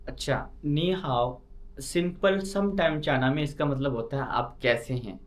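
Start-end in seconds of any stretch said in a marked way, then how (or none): none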